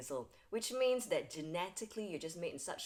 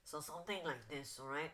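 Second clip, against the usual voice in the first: blocked nose; 7 dB quieter than usual. Shown as a demonstration. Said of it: "as if they are having a blocked nose".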